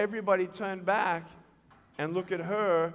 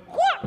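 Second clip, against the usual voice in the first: high, loud, short mimicked whoop; quick yelp; 6 dB louder than usual. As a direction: act out the leap with a sudden high yelp. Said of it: "Like that".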